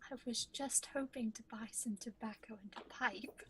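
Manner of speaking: whispered request. Acted. Hushed whisper to the shopkeeper. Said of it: "whispered repeat".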